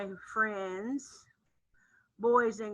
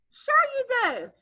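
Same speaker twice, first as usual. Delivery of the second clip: teasing; 12 semitones higher, faster than usual. Acted. Teasing the class.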